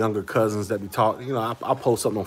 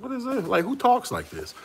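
baffled; 14 semitones higher than usual. Retold or imitated. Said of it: "I'm like".

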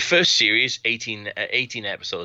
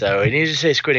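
raised, louder put-on Trump voice; Trump impression; 5 semitones higher, 6 dB louder, faster than usual. Ventriloquist's Trump coaxing the puppet, loud mock Trump voice. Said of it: "a guy doing an impression of Donald Trump".

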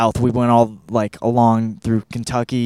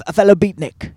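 mock English accent; playful switch into an English accent; 5 semitones higher, 5 dB louder than usual. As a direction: put on an English accent for a moment. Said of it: "I'm using English accent".

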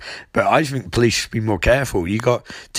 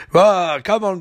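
high outburst of retold frustration; mock exasperation; 8 semitones higher than usual. [name] complaining to his AI, mock exasperation. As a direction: burst out high, as if fed up.

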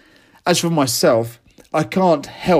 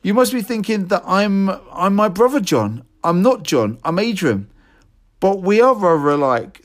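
higher, polite middle-class voice; high-pitched middle-class; 7 semitones higher than usual. Retold or imitated. Young man explaining to an old postman, high and prim. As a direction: keep it high and earnest, a bit prim. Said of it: "in my high-pitched voice, in my middle-class way".

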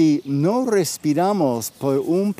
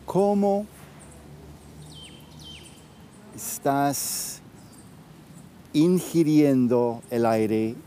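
right in your ear. Acted. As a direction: guide them gently inward, with long pauses. Leads a guided breathing practice.

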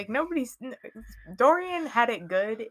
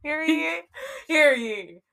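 town crier; 7 semitones higher than usual. Pretend. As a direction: ring it out like a crier. Mock town crier opening.

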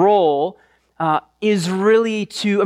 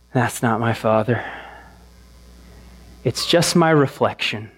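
dejected Simba; 8 semitones lower than usual. Retold or imitated. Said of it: "you could just hear it in his voice".

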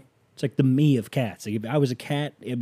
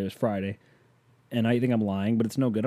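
normal talking voice

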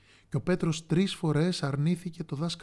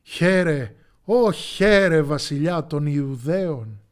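ironic mocking shout; 2 semitones higher, 8 dB louder, slower than usual. A narrator's reading. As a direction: jeer at him like a mocking soldier. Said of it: "του φώναζαν ηρωνικά".

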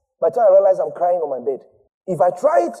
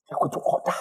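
whispered warning; 5 dB quieter than usual. Acted. Preacher telling how Nineveh was warned, whispered. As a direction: drop to a whisper for the doom.

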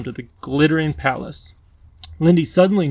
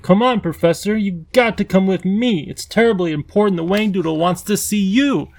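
put-on young girl, higher and urgent; eager young girl; 6 semitones higher than usual. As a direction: pull him along, eager and pleading. Lindy urging the professor.

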